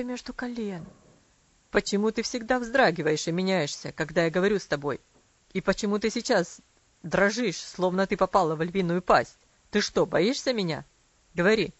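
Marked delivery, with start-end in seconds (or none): 1.7-11.7 angry father. A narrator's reading. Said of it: "Он рассердился".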